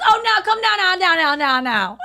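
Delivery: high mock shout that descends as it repeats; imitated reflex yell. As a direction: imitate a panicked fan hollering in accent. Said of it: "and he just goes".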